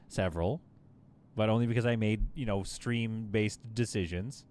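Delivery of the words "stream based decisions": ordinary talk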